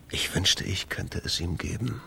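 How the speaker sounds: soft whispered thought to himself; quiet inner sigh; wistful murmur. Acted. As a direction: murmur it, regretful and alone.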